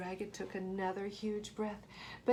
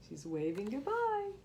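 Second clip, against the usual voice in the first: plain reading voice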